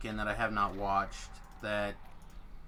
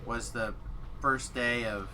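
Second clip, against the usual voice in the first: usual speaking voice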